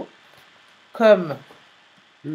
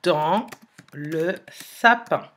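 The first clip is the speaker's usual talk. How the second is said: slow dictation with long pauses between words; dictating word by word; 2 semitones lower, slower than usual. Shown as a demonstration. Teacher writing the sentence on the board, slow dictation. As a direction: spell it out as you write it on the board. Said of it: "en écrivant la phrase".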